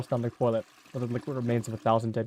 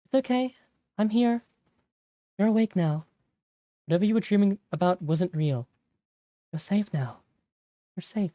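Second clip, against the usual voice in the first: higher, gentle comforting voice; reassuring; 7 semitones higher than usual. Acted. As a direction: soothe him awake. Friend comforting him after nightmare.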